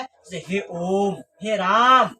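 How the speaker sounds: "हे ओम, हे राम" called slowly with long vowels; drawn-out call. Shown as a demonstration. Teacher calling out to show a prolonged vowel. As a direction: stretch "हे राम" like calling from afar. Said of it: "ये पुकारते समय जिस स्वर को लंबा खींचा जाता है".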